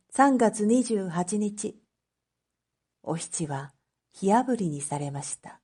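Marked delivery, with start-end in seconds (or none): none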